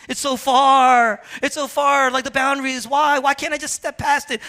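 hammed-up athlete griping about the line; whiny complaining. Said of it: "imagine him complaining about".

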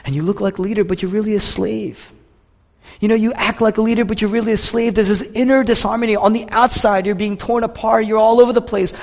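high, urgent, insistent run; impassioned confrontation. Acted. Jesus telling Martha she is all over the place, impassioned. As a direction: press each charge home, pitched well up.

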